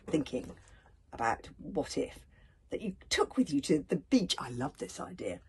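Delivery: usual speaking voice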